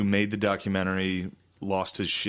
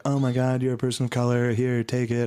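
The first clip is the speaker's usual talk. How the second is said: mock guilty apology; 3 semitones higher than usual. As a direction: ham up a gushing guilty liberal. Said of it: "It would have been sick if I was like".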